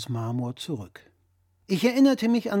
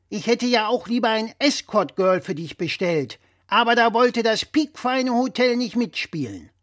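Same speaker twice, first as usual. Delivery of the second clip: louder, higher know-it-all dad voice; blustering father; 7 semitones higher, 5 dB louder than usual. Acted. Father lecturing his son over the phone, louder and higher. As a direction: play the pompous father correcting his son. Father about escort girl.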